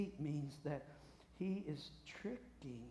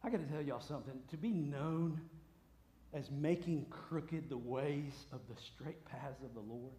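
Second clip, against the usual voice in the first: ordinary delivery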